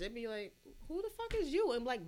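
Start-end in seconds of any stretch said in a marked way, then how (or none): 0.9-1.7 higher-pitched affronted comeback; mock indignant retort. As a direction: act out someone taking mock offense. Acting out an offended stranger's retort.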